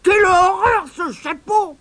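put-on high, loud old woman recoiling in disgust; disgusted granny. A narrator's reading. Grandmother grumbling at the dirty hat, disgusted. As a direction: spit it out like a fussy grandmother. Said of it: "d'un air dégoûté".

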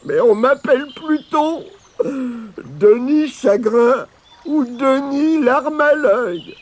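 high, drawn-out, self-pitying lament; mournful wail. A narrator's reading. Weeping Denis confessing his nicknames, wailing sadly. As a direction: wail it as the sad stranger. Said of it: "j'ai toujours été triste".

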